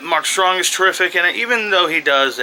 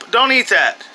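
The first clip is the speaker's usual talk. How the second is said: higher, raised warning; quick parental scolding from afar; calling out sternly; 7 semitones higher, 3 dB louder than usual. Real, not acted. Parent stopping kid eating, calling out sternly. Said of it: "gotta go yell at this kid".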